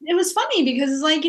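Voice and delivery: normal talking voice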